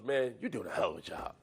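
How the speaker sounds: low, friendly rumble; big man's flattery. Retold or imitated. Big defensive lineman flattering the rookie, low. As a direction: rumble the compliment low and easy. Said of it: "he goes".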